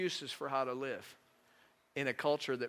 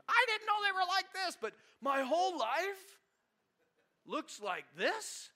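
much higher, louder, outraged whine; incredulous high protest; 4 dB louder than usual. Retold or imitated. Spouse complaining to God about marriage, squeaky mock outrage. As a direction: cry out in mock disbelief, slowing at the end.

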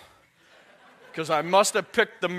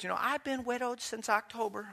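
higher, softer imitated old lady; sweet old lady; 5 semitones higher, 6 dB quieter than usual. Widow approaching visiting preacher, sweet old-lady voice. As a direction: gently put on a little old lady's voice. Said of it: "The sweet little old lady comes up to me".

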